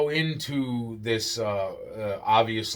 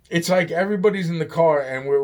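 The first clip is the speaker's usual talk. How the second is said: ordinary talk, nothing marked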